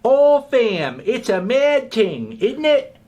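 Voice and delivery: loud, very high-pitched mock UK accent; mock UK slang accent. Critic ridiculing the rapper's borrowed lingo, mock UK accent. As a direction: ham up British road slang in falsetto.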